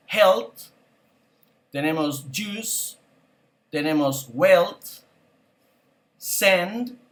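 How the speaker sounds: each word said alone, then a long pause to repeat; vocabulary drill. Shown as a demonstration. Teacher reads word list for class to repeat. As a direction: give the word and wait for the echo.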